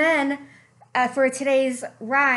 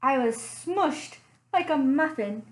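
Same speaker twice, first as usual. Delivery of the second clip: softer, storytelling read-aloud voice; story narration; 4 semitones lower, 3 dB quieter than usual. A narrator's reading. Librarian reading a bear story to children, gentle storytelling. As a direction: draw the kids into a cozy mystery tale.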